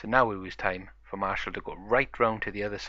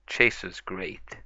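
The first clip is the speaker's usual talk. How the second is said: ordinary delivery, nothing marked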